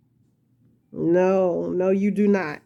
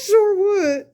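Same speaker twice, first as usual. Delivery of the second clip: real laughing squeal, much higher; helpless laughter; 11 semitones higher, 4 dB louder than usual. Real, not acted. Crafter agreeing she would take viewers' advice, helpless laughter. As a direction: squeeze the words out high through laughter.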